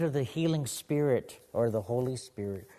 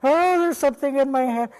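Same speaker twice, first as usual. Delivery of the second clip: loud, high, hammed-up praying healer; praying grandfather; 14 semitones higher, 12 dB louder than usual. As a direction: imitate a healer crying out in fervent prayer. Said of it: "my grandfather went towards her".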